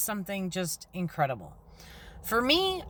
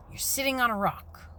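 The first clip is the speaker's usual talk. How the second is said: ordinary delivery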